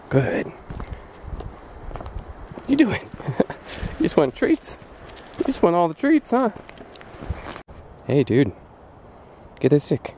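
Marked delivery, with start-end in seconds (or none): 2.6-6.5 high dog-talk voice. Real, not acted. Teasing the dog about treats.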